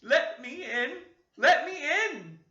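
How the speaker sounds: put-on high, slow, wailing child's voice; a lost little ghost begging; sobbing ghost child. Ghost child begging at the window, high sobbing wail. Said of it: "a most melancholy voice sobbed".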